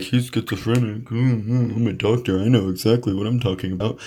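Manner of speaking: mock smug psychiatrist. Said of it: "They'll be like".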